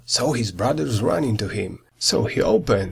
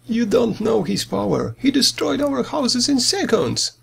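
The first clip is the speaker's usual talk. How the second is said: higher, fearful pig crying out; a scared little pig pleading, pitched up; frightened cry; 9 semitones higher than usual. A narrator's reading. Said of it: "cried Joey".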